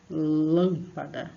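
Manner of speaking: lâmpada very slow, first syllable lâm stressed. Shown as a demonstration. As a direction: stretch out lâmpada, hitting lâm. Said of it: "a sílaba forte aqui". (tônica em lâm, bem devagar)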